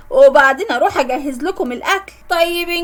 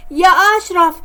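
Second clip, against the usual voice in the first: raised call across the flat; 3 semitones higher, 4 dB louder than usual. Retold or imitated. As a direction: call him loudly from the room. Calling out for Ashraf. (نداء بصوت عالٍ)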